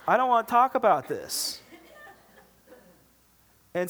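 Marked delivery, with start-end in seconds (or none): 0.1-1.6 high, loud, comic dodge; panicked evasion. Acted. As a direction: squirm out of the topic in a raised mock-panic voice. Dodging a friend's hard moral question.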